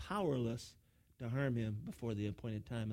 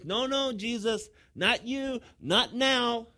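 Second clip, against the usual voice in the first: high, drawn-out cry of rejection; loud refusal; 9 semitones higher, 9 dB louder, much slower than usual. Acted. Preacher voices an unbeliever rejecting Jesus, loud emphatic refusal. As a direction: push him away with each phrase, building louder.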